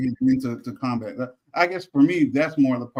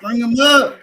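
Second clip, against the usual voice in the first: louder mock cry; 10 semitones higher, 7 dB louder, slower than usual. Mock Southern rally cry, repeated.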